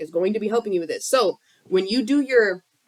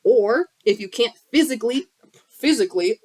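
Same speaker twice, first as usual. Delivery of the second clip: ordinary delivery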